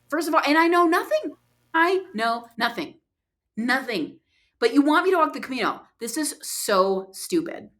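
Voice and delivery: high exasperated outburst, slowing into despair; exasperated protest. Retold or imitated. Retelling her argument with the sky, exasperated. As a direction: protest in retold disbelief, hammering it out.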